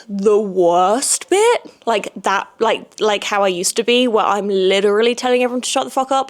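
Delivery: usual speaking voice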